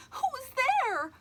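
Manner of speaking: high, loud startled call; a girl, startled, calling out; startled cry. Acted. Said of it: "Emily cried".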